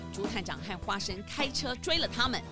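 ordinary delivery

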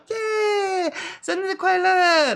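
high, slow drawn-out cheer; cheery birthday cheer. Retold or imitated. Cheering friend at the cake, high and drawn-out. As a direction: sing out the celebration.

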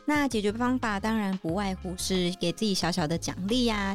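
ordinary delivery

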